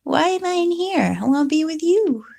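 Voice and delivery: high plaintive kitten voice; high whiny cat voice; voicing the crying cat. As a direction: plead like a shut-in kitten. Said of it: "he will cry and say".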